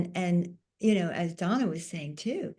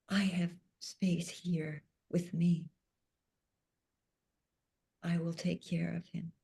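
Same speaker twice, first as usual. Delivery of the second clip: loving tone; 5 dB quieter than usual. Retold or imitated. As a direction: gently promise to take care of him. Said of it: "in the most loving tone".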